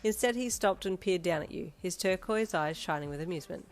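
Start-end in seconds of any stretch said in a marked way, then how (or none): none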